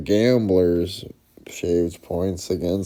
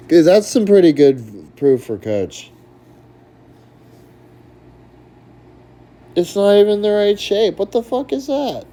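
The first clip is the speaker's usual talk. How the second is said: much higher and louder outraged yelling; furious rant; 14 semitones higher, 10 dB louder than usual. Real, not acted. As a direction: blow up at the ruling. Ranting at the bet graded as a miss.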